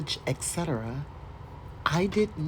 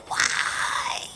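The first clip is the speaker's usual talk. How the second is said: laughing shriek. Real, not acted. Breaks into laughing cry.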